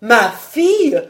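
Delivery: loud, shocked question; disbelieving, barely taking it in; stunned stammer. A narrator's reading. Vaunois hears his daughter was there, stunned. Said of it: "balbutia-t-il".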